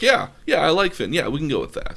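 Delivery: high, perky acted-out yes, settling as it goes; light mock enthusiasm, starting high and loud; excited acceptance. Finn taking to the name Poe gave him, excited.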